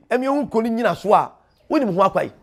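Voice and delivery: ordinary talk, nothing marked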